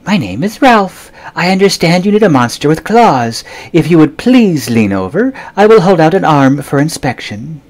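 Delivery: a dainty, fussy monster offering his arm; high silky monster voice. A narrator's reading. Ralph the monster greets the boy, high and prim.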